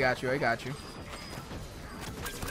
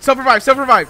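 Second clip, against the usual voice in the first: loud, high repeated instruction; real raised-voice urging; urgent call-out; 7 semitones higher, 9 dB louder than usual. Real, not acted. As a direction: shout it to your downed teammate. Begs teammate to revive.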